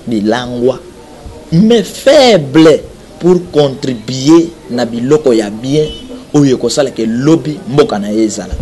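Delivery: ordinary talk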